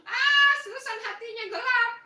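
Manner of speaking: high, loud burst of laughter into a teasing line; laughing out loud, high and loud. Real, not acted. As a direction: crack up and tease the friend with the dark picture. Teasing Susan's dark profile picture.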